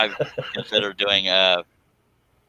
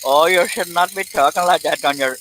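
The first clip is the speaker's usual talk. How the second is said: mock scolding; 8 semitones higher than usual. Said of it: "I had someone tell me once".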